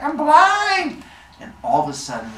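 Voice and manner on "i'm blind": high, loud mock wail; hammed-up panic. Phaethon dropping to his knees in blinding light, mock panicked cry. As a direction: cry out in exaggerated, high-pitched alarm.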